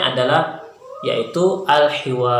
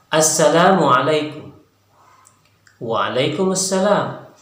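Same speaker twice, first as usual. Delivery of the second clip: slow, careful model greetings, pitched up; modelled dialogue reading; 4 semitones higher than usual. Shown as a demonstration. Teacher models Arabic greetings for students, slow and careful. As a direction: model each greeting clearly for learners.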